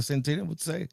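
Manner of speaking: ordinary talk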